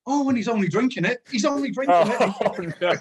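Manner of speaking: high, loud, incredulous exclamation; mock outraged disbelief. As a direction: imitate a manager's disbelief, laughter creeping in. Manager exclaiming at player drinking pop.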